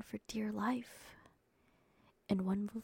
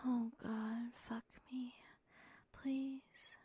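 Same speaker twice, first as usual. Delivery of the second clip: breathy plea; 4 semitones higher, 5 dB quieter, much slower than usual. Acted. Partner begging in story.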